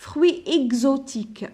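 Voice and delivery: "Fruit exotique" said slowly with the x as gz. Shown as a demonstration. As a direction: lean on the gz in "exotique". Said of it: "X entre deux voyelles". ("Fruit exotique" ببطء مع x بصوت gz)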